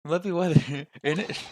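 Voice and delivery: British "innit" with a chuckle; British accent. Shown as a demonstration. Player rehearses weather small talk, laughing British accent. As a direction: slur it to a British "innit", amused. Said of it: "Gruff, gruff British voice".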